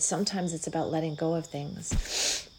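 ordinary talk

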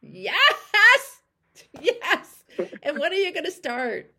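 loud, high whoop of delight; real cheer. Real, not acted. Coach cheering client's commitment, loud and high. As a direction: celebrate her answer with a shout.

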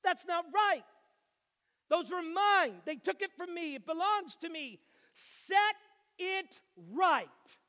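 put-on loud, high and indignant, a small boy demanding justice; the son appeals to a judge over stolen pancakes, loudly indignant; child pleading to a judge. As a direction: plead your case to the judge at the top of your voice. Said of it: "And he says".

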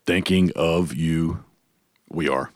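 low, very slow signature voice; catchphrase voice. Shown as a demonstration. Host asks his tagline, he answers in his deep catchphrase voice. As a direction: stretch it out low and slow, savoring each word. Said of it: "Sometimes I put the voice on".

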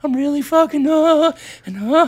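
high-pitched imitation of a fretting wife; mock a worried phone call, voice way up; hammed-up wifely worry. Retelling wife's worried phone call.

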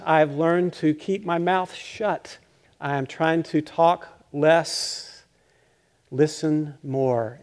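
slow each phrase down deliberately; unhurried sermon pacing; slow, measured. Acted. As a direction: talk slowly, as if practising patience. Preacher models slowing down.

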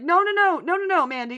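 high, rapid-fire string of objections; emphatic mock protest. Objecting to Mandy about the law, emphatic protest. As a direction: cut her off with high, insistent nos. Correcting character in imagined protest.

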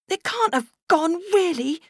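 put-on very high, wavering little girl; unconvinced. Little girl fearing they're lost, unconvinced. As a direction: say it hoping, not believing. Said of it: "without much conviction".

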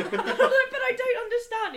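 high, slow, laughing whine; whiny protest. Retold or imitated. To Spanish friends, high slow laughing whine. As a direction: plead like a bewildered foreigner. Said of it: "I'm like".

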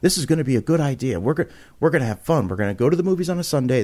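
high, rushed, insistent pitch; eager convincing. Retold or imitated. Host pleading with reluctant friends to join his podcast, eager convincing. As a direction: talk someone into it, fast and high. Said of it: "But I'm convincing".

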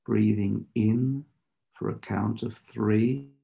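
guided meditation. Acted. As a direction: lead them into stillness, unhurried.

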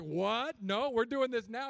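high, loud insistent retort; wife refusing to back down; mock loud insistence; wife insisting. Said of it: "she's like".

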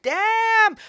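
high, stretched out damn; shocked damn. Retold or imitated. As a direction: react like it's bad news. Reacting to threat.

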